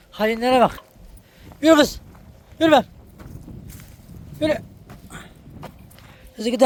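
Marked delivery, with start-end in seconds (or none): 1.6-4.6 shooing shout. Real, not acted. Woman driving her hens along, high shout. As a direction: drive the hen on with a high shout.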